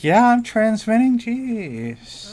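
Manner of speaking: high-pitched, drawn-out put-on reply; mock exasperation. Player answers teammate asking if they are warning the party, mock exasperation. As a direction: protest in a high, strained voice.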